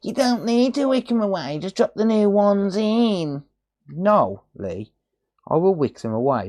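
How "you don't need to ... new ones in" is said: mocking Lee. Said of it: "He's gonna go".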